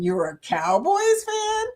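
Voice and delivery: high, slow disbelieving question; surprised question. Real, not acted. Streamer reacting to a viewer's football team, high and drawn out. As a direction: raise your voice in amused disbelief.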